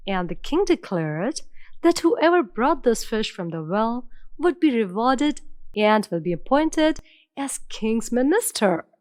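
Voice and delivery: plain reading voice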